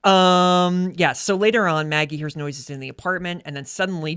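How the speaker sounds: ordinary delivery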